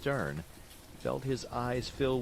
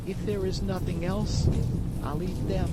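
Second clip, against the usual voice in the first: put-on reluctant boy; 9 semitones higher, 7 dB louder than usual. Pinocchio gives in.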